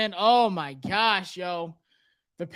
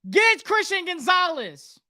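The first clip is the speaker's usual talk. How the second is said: excited urging; 10 semitones higher than usual. Real, not acted. Urging the team to pick.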